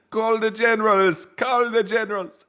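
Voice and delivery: high, slow mock commanding leader; hammed-up prime minister calling for generals; Bibi voice. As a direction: bark the orders in a high put-on voice. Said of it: "and Bibi said".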